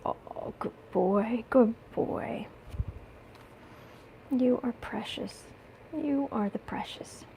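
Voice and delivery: slow, soft whisper to a pet; whispering to cat. Real, not acted. Owner whispering to her cat on her lap. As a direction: hush it lovingly to the kitty.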